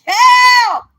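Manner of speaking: one long, very high mock scream; screaming liver. Taunting a rival with her liver, hammed-up scream. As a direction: belt out a comic piercing holler. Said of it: "that's what your liver's screaming".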